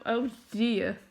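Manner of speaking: usual speaking voice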